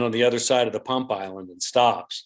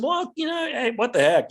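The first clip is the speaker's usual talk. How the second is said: sheriff agreeing; 12 semitones higher than usual. Retold or imitated. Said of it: "and he said".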